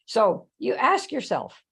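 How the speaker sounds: normal talking voice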